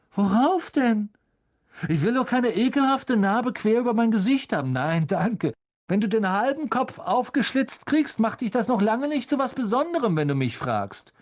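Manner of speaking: high-pitched, scornful taunting; mocking young bully; sneering schoolboy bully. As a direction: jeer at him in a high, haughty voice.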